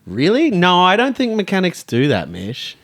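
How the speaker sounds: loud, high, over-the-top sarcasm; mock sarcastic denial. To Mish, a hammed-up sarcastic denial that mechanics are sexist, loud and high. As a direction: ham up the disbelief for a TikTok clip. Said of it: "so I've done that because".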